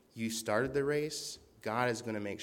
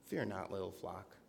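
tender; 3 semitones lower, 7 dB quieter than usual. Shown as a demonstration. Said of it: "hear Jesus talk to you like that".

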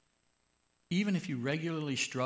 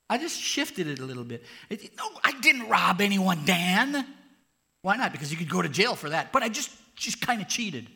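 high, loud whiny protest; defensive cheater; 7 semitones higher, 10 dB louder than usual. Acted. Cheater protesting to Dan, loud high whine. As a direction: whine your defence, cornered.

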